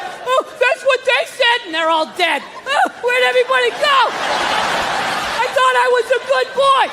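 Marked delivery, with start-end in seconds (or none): 0.0-1.7 put-on high, slow, bewildered old man; scared old man. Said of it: "And he's like". 2.7-6.8 put-on high, pleading old man's wail; scared old man.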